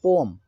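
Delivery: पोअम as po-am; correct poem pronunciation. Shown as a demonstration. Teacher repeating the dictionary reading, पोअम as po-am. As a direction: say पोअम with an am ending. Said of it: "इसको पढ़ेंगे पोअम".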